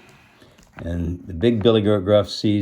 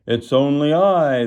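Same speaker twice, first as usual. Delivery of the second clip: lightly bleated, higher goat voice; a hint of goat in a raised voice; bleating; 6 semitones higher, 4 dB louder than usual. A narrator's reading. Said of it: "the second billy goat bleated".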